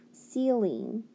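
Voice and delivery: normal talking voice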